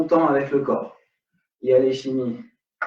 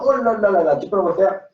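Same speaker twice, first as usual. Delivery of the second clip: mock whining students; 5 semitones higher than usual. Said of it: "Je vous entends déjà dire".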